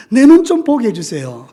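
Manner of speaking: high, loud appeal; loud plea. Acted. Preacher as the blind man asking Jesus for sight, pleading loudly.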